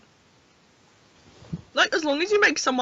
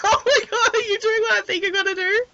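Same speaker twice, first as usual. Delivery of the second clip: high-pitched, laughing disbelief; 9 semitones higher than usual. Real, not acted. Player reacts to friend's antics, laughing hard. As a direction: crack up while asking, voice way up.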